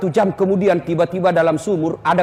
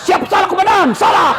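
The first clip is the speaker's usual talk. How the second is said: loud high shouted yes-sir, over and over; snapping to attention, shouting apologies; barked military reply; 9 semitones higher, 4 dB louder than usual. Retold or imitated. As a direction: bark it like a scolded cop answering his commander. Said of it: "Di kantor dimarah-marahi sama komandannya".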